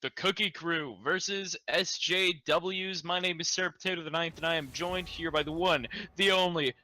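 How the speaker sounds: announcer voice. Acted. Opening show match introduction.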